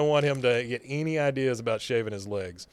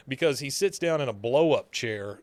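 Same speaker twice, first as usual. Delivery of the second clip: ordinary talk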